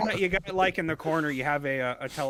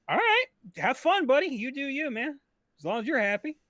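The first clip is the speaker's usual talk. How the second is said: humouring; 9 semitones higher than usual. Retold or imitated. Humouring his armed friend, retold.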